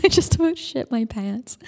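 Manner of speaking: laughing through the words. Real, not acted. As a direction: laugh as you say it. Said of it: "oh my God, I thought".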